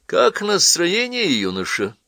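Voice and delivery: high, slow, sugary and cheeky; sweet-talking with a sneer. A narrator's reading. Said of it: "развязно спросила трубка сладеньким голоском".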